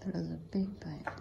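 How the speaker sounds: whispering. Real, not acted. Reacting to huge bite.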